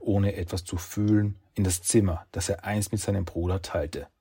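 plain reading voice

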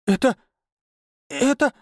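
high, stammering shock; choked astonishment. A narrator's reading. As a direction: gasp out broken words, stunned. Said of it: "Виола чуть не задохнулась".

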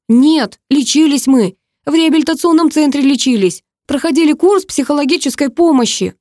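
high, loud furious tirade; yelling in anger. A narrator's reading. Brother yells the secret at his sister, high furious tirade. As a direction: rant in their face without letting up. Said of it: "проорал он мне в лицо".

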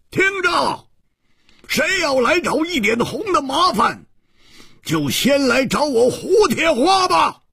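high, drawn-up battle shout; wild roar. Acted. Hu charges out to defend his friend, roaring wildly. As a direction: bellow a challenge at the top of your pitch. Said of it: "狂吼着说".

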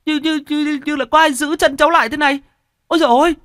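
very high, fast, stammering panic; terrified stammer. Acted. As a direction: trip over the words in terror, ending in a wail. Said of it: "anh này lắp bắp".

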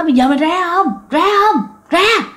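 nạt lớn giọng cao, dồn dập; quát vong phải ra ngay. Retold or imitated. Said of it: "lúc đầu bà còn nạt". (bà thầy nạt vong đứa nhỏ, giọng quát cao)

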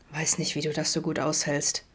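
a soft, slurred mumble lying in bed; mumbled. A narrator's reading. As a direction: mumble it half to himself. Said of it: "nuschelte Adam dann".

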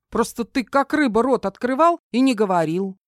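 put-on high, animated girl's voice; young woman eagerly working it out; excited girl. A narrator's reading. Voices Kara working out ability.

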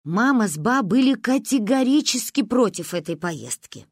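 usual speaking voice

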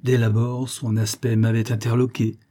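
ordinary delivery, nothing marked

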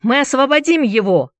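high, rallying cry from the crowd. A narrator's reading. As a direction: shout it out, pitched well up. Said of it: "закричал кто-то".

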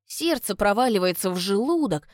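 usual speaking voice

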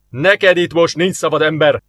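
mild growl at the human. A narrator's reading. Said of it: "mordult fel Onak". (Onák mordulva, hangosabban rászól az emberre)

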